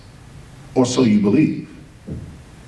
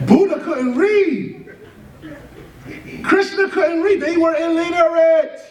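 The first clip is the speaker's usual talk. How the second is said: building emphasis; 9 semitones higher than usual. Real, not acted. Building list, rising emphasis.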